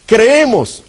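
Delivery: normal talking voice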